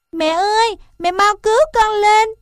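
high, tearful little elephant crying for help; a baby elephant calling up from the pit, voice pitched high; crying calf. A narrator's reading. Baby elephant in the pit begs mother, high tearful cry. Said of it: "Nó òa lên khóc nói".

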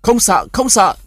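high, loud reassuring shout; Nghĩa shouting to the frightened villagers. A narrator's reading. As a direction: yell it to chase off the fear. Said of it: "cứ hét to".

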